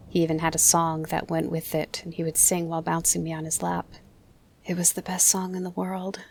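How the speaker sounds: plain reading voice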